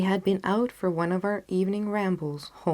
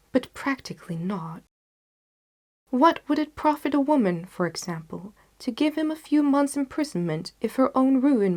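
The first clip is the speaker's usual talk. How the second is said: grave detective, raised and intense; Sherlock Holmes; 4 semitones higher, 5 dB louder than usual. A narrator's reading. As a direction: Holmes warning Watson with rising disgust. Holmes voiced in a story reading.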